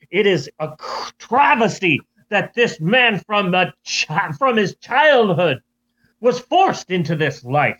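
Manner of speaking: grand, theatrical mock British accent; hammed-up British speechmaking. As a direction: campaign like a pompous British orator. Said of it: "Now he's British".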